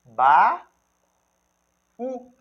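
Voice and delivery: "Ba-, u" as two syllables with a long pause between; syllable separation. Shown as a demonstration. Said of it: "Quando vou fazer a separação silábica". ("Ba-, u" separado em sílabas, com pausa longa)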